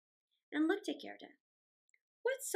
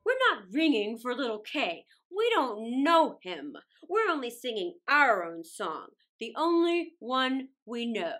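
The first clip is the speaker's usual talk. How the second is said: lower, louder, solemn bell voices; low louder flower chorus; hyacinth bells; 6 semitones lower, 7 dB louder than usual. Acted. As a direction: answer like tolling bells. Hyacinth bells answer Gerda.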